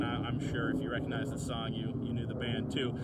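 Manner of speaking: ordinary talk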